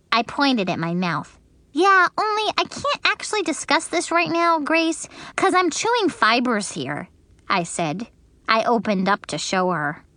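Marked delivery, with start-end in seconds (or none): 1.7-7.1 put-on higher childish voice, matter-of-fact; Junie B, little girl. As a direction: explain with a full mouth, childlike. Junie B's voice in the story.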